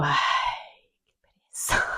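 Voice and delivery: unvoiced weary aside; whispered groan. Wife dreading husband's talk, whispered and weary. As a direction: breathe it out like you can't be bothered.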